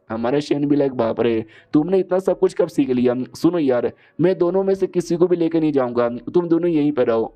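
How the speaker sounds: usual speaking voice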